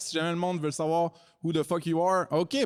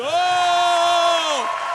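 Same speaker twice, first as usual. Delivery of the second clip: excited whoop; 13 semitones higher, 8 dB louder than usual. Real, not acted. Hyping up the pitchers.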